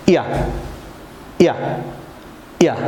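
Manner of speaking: ইয়া as a quick clipped "ya", repeated; Y sound drilled. Shown as a demonstration. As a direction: snap out ইয়া briefly each time. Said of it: "আবার বলুন".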